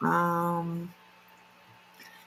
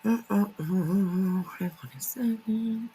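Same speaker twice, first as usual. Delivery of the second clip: whispering; 5 dB quieter than usual. Real, not acted. Fumbling with tabs mid-stream.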